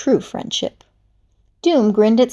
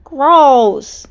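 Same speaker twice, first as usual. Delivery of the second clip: mumbled disgust; 4 semitones higher, 6 dB louder than usual. Acted. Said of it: "Snake mumbled".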